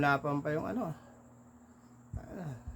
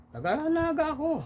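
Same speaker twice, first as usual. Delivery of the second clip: put-on high, with no trace of the Bula accent; convincing Naga accent. Bula cockfighter fools the Naga men, in a Naga accent. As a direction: pass as a Naga local. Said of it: "Walang tono".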